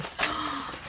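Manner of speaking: soft, high gasp of delight; amazed gasp. Real, not acted. Vlogger discovering a gorgeous sample, amazed gasp. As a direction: gasp in wonder.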